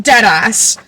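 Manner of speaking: usual speaking voice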